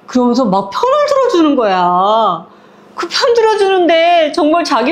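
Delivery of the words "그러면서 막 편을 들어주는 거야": usual speaking voice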